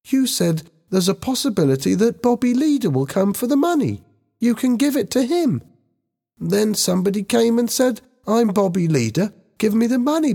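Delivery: high-pitched anxious schoolboy; young boy, frightened. A narrator's reading. Ben justifies himself to older students, frightened young boy. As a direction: explain high, as a scared boy.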